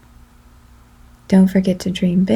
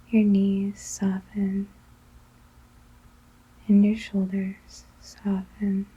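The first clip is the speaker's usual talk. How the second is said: guided meditation; 10 dB quieter than usual. Acted.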